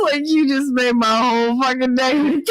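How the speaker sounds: high, tearful with laughter; squeaky retelling before a laughing fit; laughing retold quote. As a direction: squeeze the quote out while cracking up.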